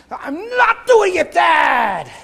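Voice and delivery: high, slow, loud protest; a son's shout. Retold or imitated. A preacher imitating his son defying Dad, high shout. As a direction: yell it like a stubborn boy refusing.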